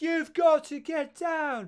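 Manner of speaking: high, slow, drawn-out wail; mock heroine's plea. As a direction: stretch it out like a melodramatic damsel. Acting out film heroine's plea.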